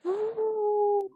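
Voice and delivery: high ooh. Real, not acted. Reacting to reality show drama.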